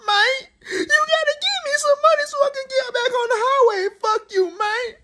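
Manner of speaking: high, whiny mock-crying girl voice with laughs breaking in; mock distressed girl. Woman texting him that her tire popped and begging for cash, in a hammed-up high crying voice. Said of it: "She sent me a message like".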